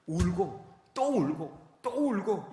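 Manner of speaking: slow, loud, drawn-out retelling of wailing. Retold or imitated. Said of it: "하나님 앞에 통곡을 합니다". (통곡을 힘주어 길게 묘사하듯)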